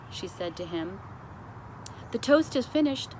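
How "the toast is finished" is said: plain reading voice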